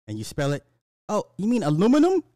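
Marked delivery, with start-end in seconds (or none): 1.1-2.2 high, loud surprised correction; imagined store clerk. Pretend. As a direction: play a clerk who finally gets it, pitched up. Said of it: "the clerk or whatever".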